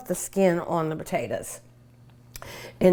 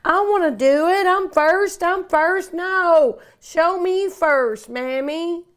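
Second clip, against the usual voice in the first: put-on high-pitched child clamoring to go first; eager grandkids; 7 semitones higher than usual. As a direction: play excited little ones begging Mammy. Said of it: "I'm sure they're gonna be".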